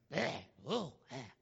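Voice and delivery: low, short mock retching grunts; disgusted grunts. Preacher acting out tasting bitter water, disgusted grunts. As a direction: grunt as if spitting out a foul taste.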